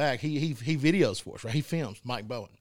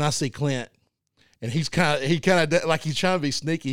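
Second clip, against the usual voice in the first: ordinary talk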